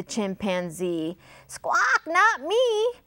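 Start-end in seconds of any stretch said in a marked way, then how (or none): 1.5-2.9 high parrot squawk of refusal; screechy storybook parrot. A narrator's reading. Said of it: "said the parrot".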